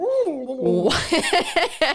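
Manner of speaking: warbling tape-rewind noise; rewind sound. Pretend. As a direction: make a wobbly rewind sound. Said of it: "Sounded like an alien underwater".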